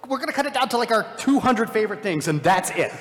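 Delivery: high-pitched giddy gushing; excited. Acted. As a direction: gush like an over-excited filmmaker. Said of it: "we get excited".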